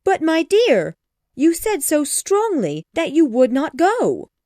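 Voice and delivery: high, loud eager puzzlement; breathless with excitement; excited wife. Acted. Mrs Bennet puzzled.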